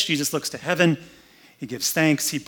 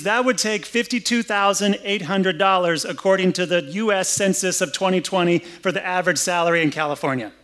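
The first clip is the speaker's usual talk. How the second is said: ordinary talk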